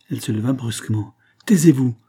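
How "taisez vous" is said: loud angry cry; loud irritated command; irritated outburst. A narrator's reading. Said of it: "s'écria-t-elle, irritée".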